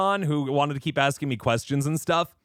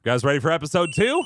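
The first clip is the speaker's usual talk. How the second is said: usual speaking voice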